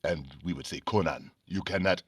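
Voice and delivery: low, soft African-accented character voice. Shown as a demonstration. Comic fan voicing tribesmen from Conan, African accent. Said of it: "I was doing all these accents".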